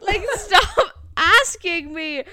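slow, loud, laughing through the words; exasperated laughing plea; laughing exasperation. Real, not acted. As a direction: laugh while begging them to stop. Fed up, laughing about customers.

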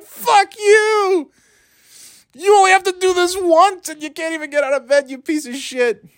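very high-pitched comic rant, easing down at the end; mock outrage. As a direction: squeal the curses in hammed-up fury. Acts out cursing his sleeping friend.

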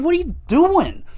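high, incredulous squeal at the team; mock disbelief, voice flying up high; exasperated what are you doing. Leafs fan scolding management, incredulous high squeal. Mocking the Zaitsev contract.

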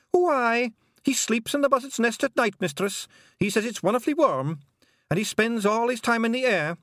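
cheeky wee Scots pixie; Scottish pixie. A narrator's reading. Feegle pixie voiced in story.

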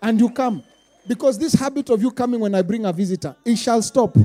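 loud preaching rebuke; forceful, loud decree. Acted. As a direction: scold them from the pulpit. Scolding the congregation.

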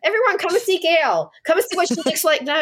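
lightly voiced housemate calling everyone over, pitched high; imitating housemate. Voicing housemate showing her off.

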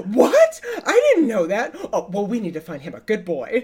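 high, loud, laughing disbelief; surprised exclamation. Retold or imitated. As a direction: act shocked and delighted. Coworker's surprised voice.

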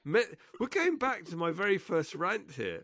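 high-pitched indignant outcry; exasperated protest. Real, not acted. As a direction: protest in a squeaky, incredulous pitch. Mock rant defending pineapple dislike.

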